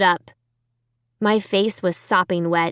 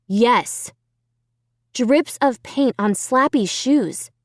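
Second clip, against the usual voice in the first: ordinary delivery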